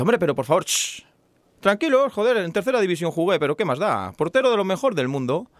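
brash high-pitched boast; cocky Basque man. Acted. Basque man shouting up to the trapped people, cocky and high. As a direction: call up reassuringly, full of swagger.

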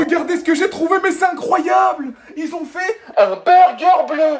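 loud and thrilled, slowing down; excited shout. Real, not acted. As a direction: shout it out with amazement. Excited reveal of blue burger.

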